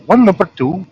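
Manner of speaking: high, prim posh take on the question; posh British accent. Retold or imitated. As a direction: sound like posh people offering sugar. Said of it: "I try to say it like the posh people say it".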